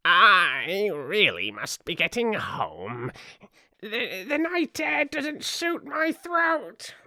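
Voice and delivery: slow, high, quavering bird; old magpie. A narrator's reading. Old magpie excusing itself to go home, quavering. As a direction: draw it out in a high, swooping old voice. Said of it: "One old magpie".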